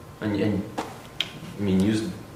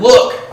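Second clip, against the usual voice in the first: "Look" called out high and loud; emphatic "Look"; 12 semitones higher, 8 dB louder than usual. Retold or imitated. Older son confronting his father, an emphatic "Look". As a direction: snap "Look." sharply to grab his attention. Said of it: "there it's emphatic".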